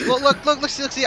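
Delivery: sudden excited look look look. Real, not acted. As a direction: grab everyone's attention in excitement. Excited at mech fight.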